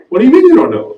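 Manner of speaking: high, loud disbelieving question; incredulous. Retold or imitated. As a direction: act out baffled disbelief. Retold disbelief at friend's answer.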